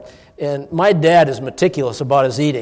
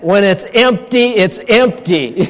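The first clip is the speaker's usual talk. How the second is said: laughing; 5 semitones higher, 5 dB louder, slower than usual. Retold or imitated. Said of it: "I said".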